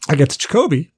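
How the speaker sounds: ordinary talk, nothing marked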